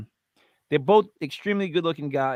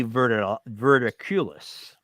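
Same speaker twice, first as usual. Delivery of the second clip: slow, drawn-out spooky vampire voice; 4 semitones lower, slower than usual. Acted. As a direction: drag it out like a vampire. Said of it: "like some sort of vampire".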